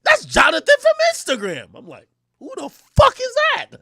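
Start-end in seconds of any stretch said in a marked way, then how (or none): 0.0-1.7 high, loud imitation of the girl; mock her odd, excited announcement; weird way. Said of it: "she said in a weird way". 2.4-3.8 very high, loud squawk of disbelief; baffled outburst. Retold or imitated. Baffled comeback to her announcement.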